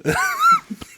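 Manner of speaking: laughing in a high squeal; high squeal of laughter. Real, not acted. Blooper laughter.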